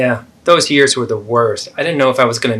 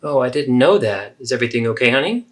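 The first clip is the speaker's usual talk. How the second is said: softer, caring mother; the kind mother in the second take; supportive mother; 6 semitones lower, 7 dB quieter than usual. Acted. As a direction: gently reassure your child. Supportive mom version.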